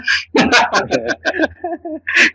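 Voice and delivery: loud, high disbelieving laugh; burst of laughter. Real, not acted. Laughing out loud after hearing there are 160 questions. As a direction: laugh out in real shock.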